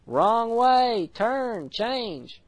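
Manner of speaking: high, stretched-out calls, easing off in volume; held-back holler, pitched high; imitated yelling. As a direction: call out warnings from a distance, without full force. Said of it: "Holy Spirit on the inside yelling".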